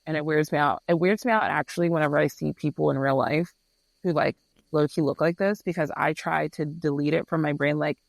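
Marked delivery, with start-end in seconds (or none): none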